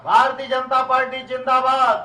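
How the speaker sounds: high, loud chanted slogan; rally slogan shout. Real, not acted. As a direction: rouse the crowd with the party cheer. Leader leads party slogan.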